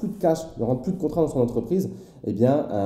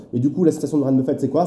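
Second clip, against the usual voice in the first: usual speaking voice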